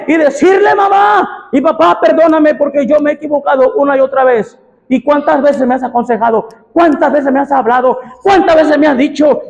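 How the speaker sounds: voz alta y aguda, clamando; predicación a todo pulmón. Acted. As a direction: suplica a gritos desde el púlpito. Preaching at full force. (predicador clamando perdón a mamá y papá)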